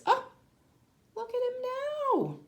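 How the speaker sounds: ordinary delivery, nothing marked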